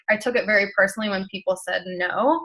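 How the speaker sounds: usual speaking voice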